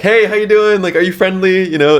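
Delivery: high, bright pitch to a passing shopper; high friendly sales greeting; old school salesman pitch. Retold or imitated. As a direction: play the eager mall seller. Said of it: "sell it, like, old school style".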